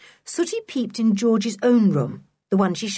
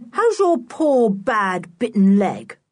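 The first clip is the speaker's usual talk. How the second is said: cheeky, louder mock sympathy; 2 semitones higher, 5 dB louder, slower than usual. A narrator's reading. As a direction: rub it in with fake pity. Boy mocking Block's bitten leg.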